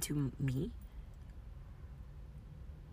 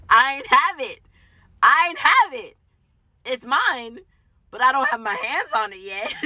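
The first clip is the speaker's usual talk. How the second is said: high, loud cry between bursts of laughter; laughing exclamation; 9 semitones higher, 13 dB louder than usual. Real, not acted. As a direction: shout it out, breaking into laughter.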